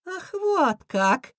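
high, drawn-out cartoon squeal; high villain voice, slow and stretched. A narrator's reading. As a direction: squeal in sudden indignation. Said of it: "взвизгнул Крысион".

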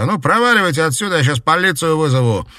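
fast, high, furious yelling; angry shout. Acted. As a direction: drive them off with a yell. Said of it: "злобно выкрикнул он". (злобный крик)

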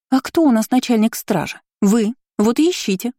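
put-on high-pitched, snippy young woman; indignant retort. A narrator's reading. Aristocrat telling guard captain to search, indignant. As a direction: toss the duty straight back at him.

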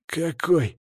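a hint of a croaky challenger; wheezed question. A narrator's reading. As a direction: let the word wheeze out. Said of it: "просипел Пахан".

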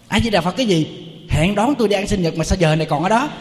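mock an angry girlfriend chewing out the monk; angry woman. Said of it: "nó la um sùm hết trơn".

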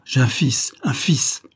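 put-on an old father who just learned he has a son; overjoyed cry. A narrator's reading. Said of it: "s'écria le vieillard".

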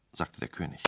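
ordinary delivery, nothing marked

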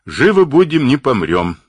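emphatic comeback, pitch dropping by the end; curt, decisive retort. A narrator's reading. Игнат overrules the grumbler about freezing, curt and decisive. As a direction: cut the doubt off, starting high and loud. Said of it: "отрубил Игнат".

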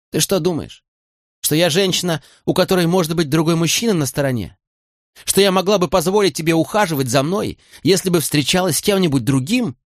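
a mild cold, cutting question; lightly chilled, accusing; icy voice. A narrator's reading. Molly coldly asking Fitz if she seems unfaithful, icy. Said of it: "спросила ледяным голосом".

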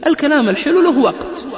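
high, loud mock lecturing; know-it-all adviser. Smug man warns against sweet words to wives, mocking. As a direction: ham up a smug man giving bad advice. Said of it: "قال".

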